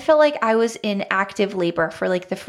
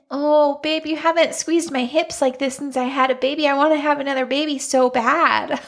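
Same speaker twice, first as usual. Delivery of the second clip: high, gushing imitation of weepy longing; mock emotional; 5 semitones higher than usual. Emotional wife pleads with her husband for another baby, mock tearful. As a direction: whine it higher, playfully tearful. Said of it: "I just am so emotional".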